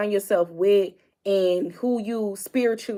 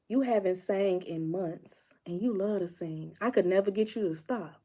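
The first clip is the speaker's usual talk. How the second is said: soft; 9 dB quieter than usual. Retold or imitated. Roommate's gentle remark, retold.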